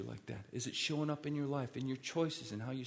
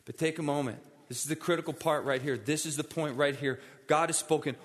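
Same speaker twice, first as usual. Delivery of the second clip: loud, insistent preaching; forceful pulpit urging in a raised voice; urgent altar appeal; 3 semitones higher, 5 dB louder than usual. Acted. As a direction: press the congregation hard for a response.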